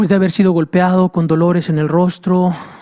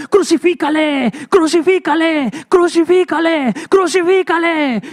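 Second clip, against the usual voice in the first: preacher voicing the crowd screaming for crucifixion; crowd shouting; 10 semitones higher than usual. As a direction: bellow it like a mob demanding death. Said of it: "empezó a levantarse la voz".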